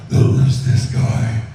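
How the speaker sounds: very slow, loud, whispery growl; slow, loud, rasping brute; second monster voice. Shown as a demonstration. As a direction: drag it out slowly like a hulking creature. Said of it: "Hulk and Grundy are a good example of that".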